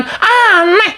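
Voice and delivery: high, scornful aneh. Real, not acted. Host scorning the accusers, high scornful. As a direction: spit out the verdict.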